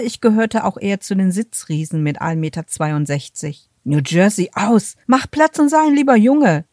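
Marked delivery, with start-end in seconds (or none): 3.8-6.7 higher, louder, hurried-sounding command to a dog; hectic call. A narrator's reading. Man calling off his dog as it jumps on a guest, hectic. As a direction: call out frantically to an unruly dog. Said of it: "rief der attraktive Mann hektisch".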